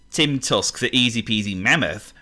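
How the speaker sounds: ordinary delivery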